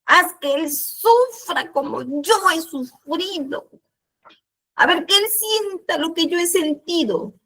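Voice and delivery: aguda y lenta, pidiendo venganza; pídelo con rencor; súplica resentida. Retold or imitated. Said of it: "le dije así". (pidiéndole a Dios que él sufra, agudo y resentido)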